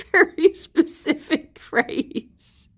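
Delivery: slow words broken by laughter; laughing through words. Real, not acted. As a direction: laugh while getting the words out. Laughing at Geordie phrase.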